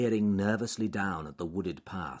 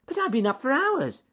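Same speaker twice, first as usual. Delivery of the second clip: loud, very high eager reply; squeaky rabbit; 8 dB louder than usual. Acted. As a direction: squeak it back brightly. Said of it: "squeaked Ernest Fluffy Rabbit".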